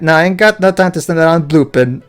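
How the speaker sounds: robot chef with Welsh lilt; sing-song Welsh robot; Welsh accent. Acted. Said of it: "when did you become Welsh".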